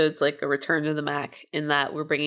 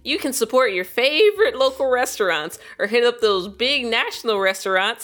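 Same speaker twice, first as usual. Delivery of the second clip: put-on raised, hollering old miner twang; old-timey cowboy voice; 4 semitones higher, 3 dB louder than usual. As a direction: keep up the folksy cowboy miner pitch. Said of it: "my old-timey, uh, cowboy miner voice".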